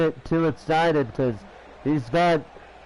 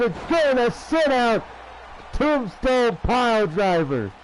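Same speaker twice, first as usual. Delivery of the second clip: wrestling commentator; 4 semitones higher than usual. Real, not acted. Live play-by-play of wrestling match.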